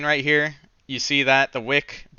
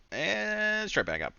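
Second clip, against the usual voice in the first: drawn-out; 9 semitones higher, 9 dB quieter, slower than usual. Shown as a demonstration. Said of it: "monster red candle".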